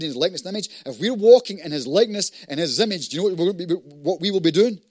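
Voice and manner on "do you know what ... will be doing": usual speaking voice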